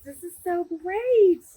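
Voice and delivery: normal talking voice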